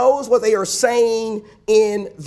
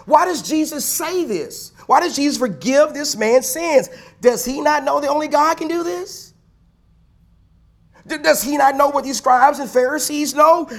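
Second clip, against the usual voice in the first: high, insistent rhetorical questions; incredulous questioning; 7 semitones higher than usual. Acted. Preacher voices the congregation's questions about Jesus, incredulous. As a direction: pile up astonished questions in a raised pitch.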